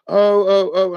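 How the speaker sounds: high, loud fretful oh oh oh; mock fretting friend. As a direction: play the fussing friend. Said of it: "she was like".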